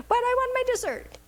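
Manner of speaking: put-on high, whining six-year-old wanting her dessert; whiny granddaughter. Grandmother imitating a six-year-old's tantrum, high and whiny. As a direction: whine it like a kid denied a treat. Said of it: "She starts to want to fit".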